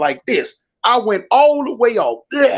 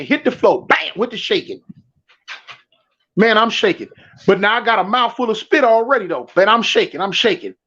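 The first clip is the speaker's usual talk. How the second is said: ordinary talk, nothing marked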